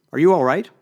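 loud, urgent question; loud concern. Acted. Snapping fingers at a dazed friend.

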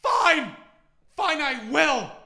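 high, loud, hammed-up huffy reply; exasperated posh woman. Hannah giving in to Bernard, hammed-up exasperated shout. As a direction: give in with a loud huff. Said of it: "And she just goes".

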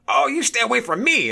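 high, loud mock retort; mock indignant shout. Older shopper answering "stay off of me", mock indignant shout. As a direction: imitate an affronted man yelling back. Retold man snapping back.

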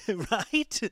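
ordinary talk

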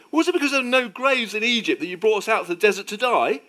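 high, loud panicked complaint; terrified outcry. Acted. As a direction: cry out in alarm at your leader. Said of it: "they were terrified and cried out".

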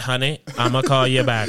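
low, brushing-off daddy voice with a laugh; dismissive dad on phone. Retold or imitated. Dad brushing off his daughter's call, low and quick. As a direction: wave the kid off lightly. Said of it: "It's clearly not important".

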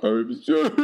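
high, sobbing dragon apology; hammed-up creature sobbing; crying dragon. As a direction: blubber it as a weeping beast. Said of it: "Are you crying fire?".

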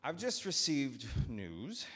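slow, measured opening; slowly. Acted. Master confronting his steward, beginning slowly. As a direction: weigh each word. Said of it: "he began slowly".

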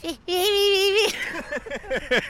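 loud, very high squeal ending in a laugh; piercing squeak breaking into giggles; mouse squeak. Shown as a demonstration. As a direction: squeal like a houseful of mice. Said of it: "What about this?".

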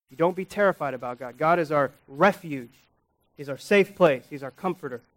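usual speaking voice

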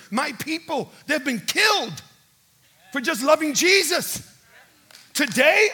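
high, loud impassioned rebuke; indignant outburst. Retold or imitated. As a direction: thunder it out, building to a shout. Retelling his angry outburst at official.

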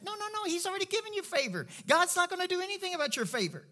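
high, fast, insistent rebuttal; emphatic correction. Real, not acted. Preacher correcting what people think about favor, insistent. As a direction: jump in and overrule the idea.

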